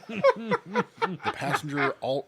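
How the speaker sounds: high breaking laugh, slow; cracking up mid-sentence. Real, not acted. Breaks up while reading.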